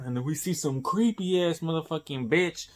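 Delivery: ordinary talk, nothing marked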